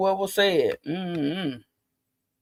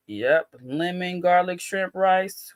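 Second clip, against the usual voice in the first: oddly drawn out; slower than usual. Real, not acted. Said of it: "I don't know why I said it like that".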